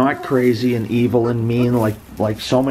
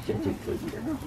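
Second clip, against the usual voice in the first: soft, high squeaky coo; cooing pet talk; 13 dB quieter than usual. Real, not acted. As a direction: praise the dog in a tiny voice. Cooing to the dog.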